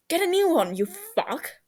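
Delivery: high, mocking retort; snarky. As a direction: toss it back cheekily. Bickering retort.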